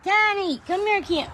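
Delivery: high sing-song calling to the cat. Real, not acted. Woman calls her cats in baby voice. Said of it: "baby voice to their pets".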